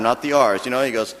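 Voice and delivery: usual speaking voice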